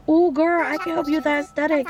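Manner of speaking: sassy excitement. Real, not acted. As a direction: hype the client up. Hyping up villager client.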